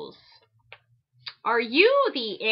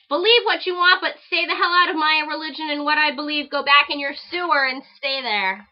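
loud, higher angry reading; all caps rant; 4 semitones higher, 6 dB louder than usual. Retold or imitated. Reading a hate letter written in capitals, loud and angry. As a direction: shout it like an all-caps email. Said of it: "All caps".

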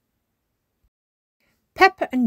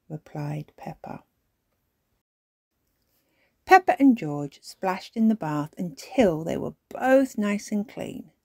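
ordinary delivery

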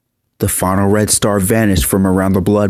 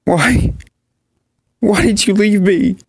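high, choked-up plea; 10 semitones higher than usual. Acted. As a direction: ask it through a tightening throat. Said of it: "he choked up".

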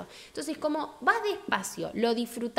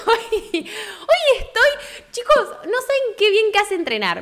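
higher, louder, laughing through it; 10 semitones higher, 7 dB louder than usual. Real, not acted. Greeting her chat, laughing excitedly. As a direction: laugh while you start the story.

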